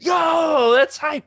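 high, loud burst of glee; mock villain cheer, shouted high; gleeful shout. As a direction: blurt it out like a kid who just won. Palpatine's secret glee.

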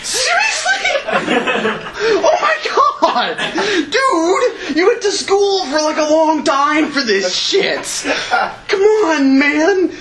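high, outraged laughing rant; exasperated shouting. Retold or imitated. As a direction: yell it in disbelief at the medic. Berating the panicking medic.

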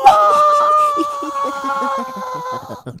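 very high, loud shrieking laugh. Real, not acted. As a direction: burst into a shrill cackle. Laughing after the scream.

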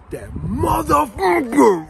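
slow, loud curse broken by laughter; mad laughing curse. Retold or imitated. Telling a viewer how the coworker's joke made him mad, loud laughing curse. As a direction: drag it out loud, half laughing. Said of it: "I immediately got mad".

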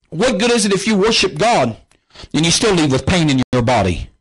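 normal talking voice

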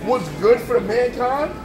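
normal talking voice

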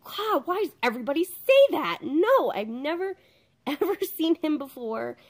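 high, loud, exasperated denial; fed up, protest it way up. Retold or imitated. Annoyed at everybody's assumption.

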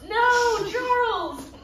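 high, loud disappointed shout; dismayed cry. Real, not acted. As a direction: groan out the driver's name. Cheering an F1 race.

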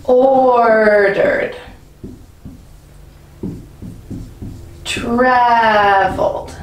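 "Ordered. Traveled." drawn out, first syllables strongest; stress on first syllable. Shown as a demonstration. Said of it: "emphasis is on that first syllable".

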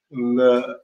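ordinary talk, nothing marked

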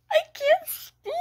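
high, laughing through overwhelmed tears; too giddy to get words out; laugh-crying. Real, not acted. Overwhelmed by the new set, laugh-crying high.

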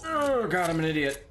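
high exasperated cry at yourself; dismayed outburst. Real, not acted. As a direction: yelp at your own mistake. Botched shot in game.

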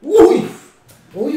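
sudden high yelp; surprised yelp. Real, not acted. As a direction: cry out at the near miss. Near miss.

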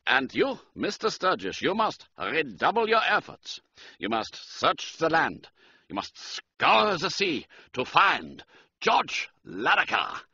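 high, urgent German count; emphatic German-accented appeal. A narrator's reading. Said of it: "He spoke with a German accent".

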